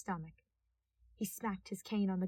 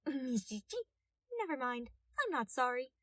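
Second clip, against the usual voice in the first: put-on cheeky young detective; 4 semitones higher than usual. Cheeky detective brushing thief off.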